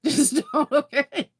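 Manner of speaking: laughing final warning; laughing plea. Real, not acted. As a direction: giggle a firm don't.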